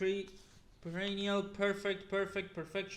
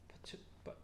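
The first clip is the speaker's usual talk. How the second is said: whispered; 16 dB quieter than usual. Real, not acted. Unvoiced mumble.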